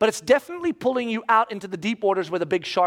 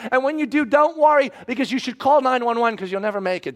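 loud, high, urgent rant; worked-up, emphatic comic alarm; mock panicked warning; 7 semitones higher, 5 dB louder than usual. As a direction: blurt a frantic warning sign. Acted rant at sign makers.

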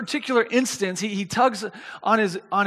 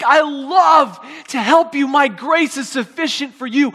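loud, high, impassioned promise; fervent assurance; 6 semitones higher, 6 dB louder than usual. Acted. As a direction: answer as God, stretching the opening words with preacher's fervour. God answering the plea, preached.

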